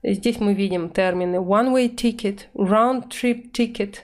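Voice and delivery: usual speaking voice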